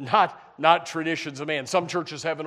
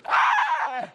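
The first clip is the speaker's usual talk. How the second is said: mock shocked gasp; 12 semitones higher than usual. Mock outrage at prison baptism.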